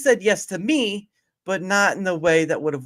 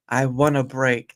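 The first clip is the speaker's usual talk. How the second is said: usual speaking voice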